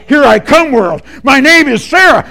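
high, forceful, proud announcement; loud declaration. Retold or imitated. Coached girl finding her voice, loud and bold. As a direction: raise the voice and proclaim it. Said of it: "Say it louder".

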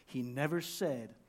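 ordinary talk

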